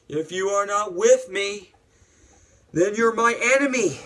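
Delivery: hammed-up defiance; acted angry threat. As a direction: deliver the threat in a high shout. Acts out Anakin's threat.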